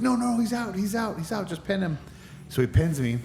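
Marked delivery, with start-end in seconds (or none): 0.0-2.0 high, insistent referee call; hurriedly insist, pitched well up; urgent referee. Retold or imitated. Referee urgently calling the finish.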